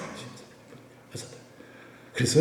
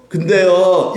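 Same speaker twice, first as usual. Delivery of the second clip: skeptical objection with a raised voice; doubting but; 6 semitones higher, 6 dB louder, much slower than usual. Shown as a demonstration. Preacher showing the wrong response to Jesus, doubtful. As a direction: voice a reluctant objection. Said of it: "이렇게 되면 안 돼요".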